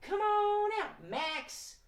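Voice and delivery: high, drawn-out sing-song call. Shown as a demonstration. Host leads everybody calling Max again, high calling out. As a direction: call out high for a hidden cat. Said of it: "Let's call out Max the cat".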